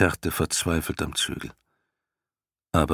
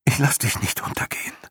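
breathy whispered promise; 7 semitones higher, faster than usual. A narrator's reading. Boy Atreo whispers to his drowning horse in his arms. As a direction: hold him close and whisper the promise. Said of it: "flüsterte er".

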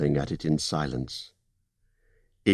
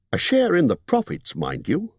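高めの声で念を押すホビット; 利益の取り分を強調する商談口調; Bilbo's voice; 11 semitones higher than usual. Acted.

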